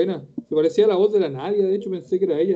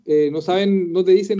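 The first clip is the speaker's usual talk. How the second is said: ordinary talk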